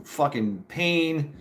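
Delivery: ordinary delivery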